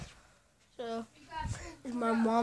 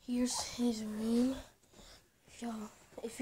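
hushed, softer house-tour voice; talking quiet; 8 dB quieter than usual. Real, not acted. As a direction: keep it down while showing the rooms. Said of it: "I don't know why I'm talking quiet".

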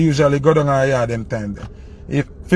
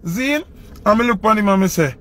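ordinary delivery

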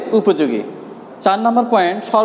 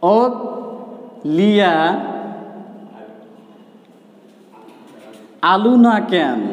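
Bogura dialect; much slower than usual. Shown as a demonstration. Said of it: "আঞ্চলিক ভাষায় এখানে যদি বলি".

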